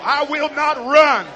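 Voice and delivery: preaching. Real, not acted. Preacher leading loud declarations.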